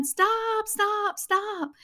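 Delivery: high, urgent repeated call; retold frantic stop call; frantic yelling. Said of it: "waving his arms, going".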